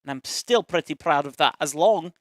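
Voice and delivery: lilting Scottish accent. Shown as a demonstration. Telling viewers about a Scottish game character, Scottish accent. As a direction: talk like a Scot, accent held throughout. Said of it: "talking like this with an accent".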